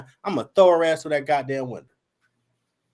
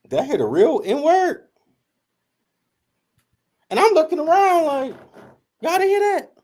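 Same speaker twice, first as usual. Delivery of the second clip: shocked disbelief; 11 semitones higher, 3 dB louder than usual. Retold or imitated. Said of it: "And I'm like".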